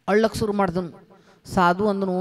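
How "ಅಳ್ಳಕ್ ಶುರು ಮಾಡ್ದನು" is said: ordinary delivery, nothing marked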